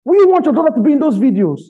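very high, loud, fast shout; outraged shouted challenge. Real, not acted. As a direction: fire the question out at the top of the voice. Same challenge shouted harder.

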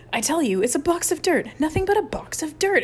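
high, exasperated outburst; indignant, rising rant; angry exclamation. A narrator's reading. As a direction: insist furiously, climbing in pitch. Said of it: "exclaimed Chip angrily".

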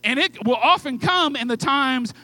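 slow, loud preaching shout. Real, not acted. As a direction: hammer it home to the congregation. Preacher driving the point home.

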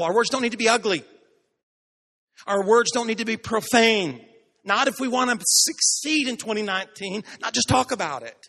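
fiery sermon climax; full-force preaching. Acted. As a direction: drive the point home to the congregation.

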